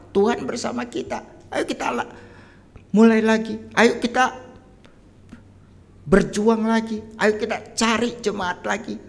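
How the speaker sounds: high-pitched, urgent rallying cry; fired-up preacher pep talk; rousing exhortation. Real, not acted.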